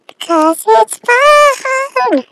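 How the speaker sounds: high, loud, slow singsong. Pretend. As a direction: squeal it out like a child.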